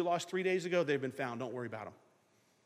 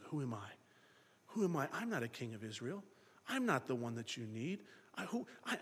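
humble; 5 semitones lower, 8 dB quieter than usual. Retold or imitated. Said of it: "answering here in humility".

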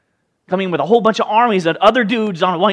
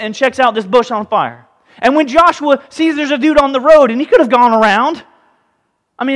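normal talking voice

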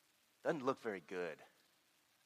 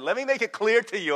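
insistent God voice; 12 semitones higher, 12 dB louder than usual. Retold or imitated.

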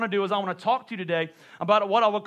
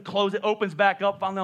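usual speaking voice

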